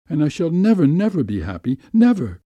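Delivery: high, emphatic cries of never; despair, leaping up on the last word; anguished lament. A narrator's reading. Anguished woman laments to lover.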